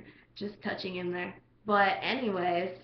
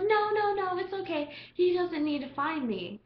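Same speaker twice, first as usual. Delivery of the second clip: mock insistent guy; 8 semitones higher than usual.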